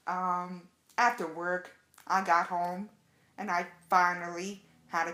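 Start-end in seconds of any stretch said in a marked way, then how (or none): none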